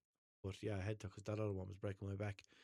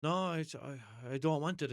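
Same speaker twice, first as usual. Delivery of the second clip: slow, louder, higher insistent no; emphatic refusal; 6 semitones higher, 6 dB louder than usual. Retold or imitated. Caller refusing the phone agent's offer, emphatic. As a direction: dig in against the sales pitch. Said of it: "And I was like".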